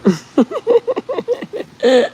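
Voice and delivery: high burst of laughter; big laugh. Real, not acted. Vlogger after joking to viewers, a big high laugh. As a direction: let out a big, high laugh at her own joke.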